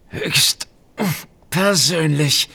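forced out through gritted teeth. A narrator's reading. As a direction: squeeze the words through clenched jaw. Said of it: "bringt dieser zähneknirschend hervor".

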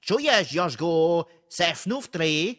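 high, loud foreign call; laute Frage. A narrator's reading. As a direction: shout the question through a door. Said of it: "klopfte heftig und fragte laut". (lautes, hohes Rufen durch die Tür)

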